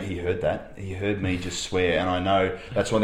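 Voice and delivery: put-on a kid caught swearing, quietly panicking; half-whispered dread of a child caught out; scared kid muttering. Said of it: "They're going".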